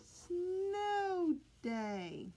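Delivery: Snow day said slowly, Snow stretched high; teaching a new word. Shown as a demonstration. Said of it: "That's our words today".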